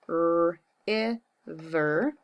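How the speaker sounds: "R, I, ver" split sound by sound; sounding out words. Shown as a demonstration. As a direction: stretch "river" in parts. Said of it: "my sound power".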